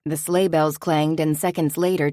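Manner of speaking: ordinary delivery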